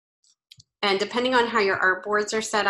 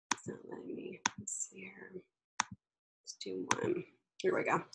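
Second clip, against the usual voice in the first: whispering to herself; 13 dB quieter than usual. Real, not acted. Muttering to herself at screen.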